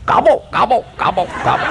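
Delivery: high-pitched mimicked gobbling; turkey gobble.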